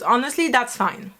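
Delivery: ordinary talk, nothing marked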